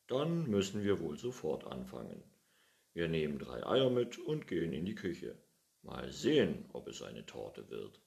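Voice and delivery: happily starting the baking; cheerful old man. Acted. Pettersson telling the cat they'll start now, hearty.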